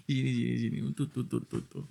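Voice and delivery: soft hushed coos while cuddling; hushed cooing as he pulls her in. Acted. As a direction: coo to her very softly, almost under the breath. Cuddling girlfriend close.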